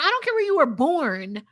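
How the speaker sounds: normal talking voice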